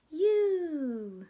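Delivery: high excited call. Acted. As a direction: cheer it out. Happy reunion line in the story.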